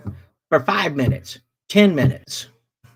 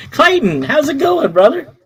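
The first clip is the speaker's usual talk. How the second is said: cheerful greeting; 8 semitones higher, 4 dB louder than usual. Real, not acted. Greeting a viewer.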